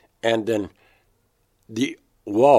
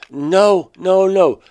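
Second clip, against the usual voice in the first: retold alarm, much higher and much louder; panicked no; 10 semitones higher, 11 dB louder, much slower than usual. David seeing the baby held wrong, panicked. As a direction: cry out to stop her.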